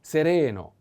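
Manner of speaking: "Sereno" with the middle "re" leaned on; stressed middle syllable. Shown as a demonstration. Teacher models the adjective for learners, "Sereno" stressed on RE. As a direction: stretch the second e in "Sereno". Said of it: "The accent is in".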